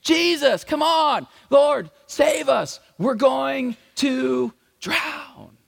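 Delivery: high, loud, slow frantic cry for help; panicked yelling. Retold or imitated. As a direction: shout it like men about to drown. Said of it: "they were yelling and screaming".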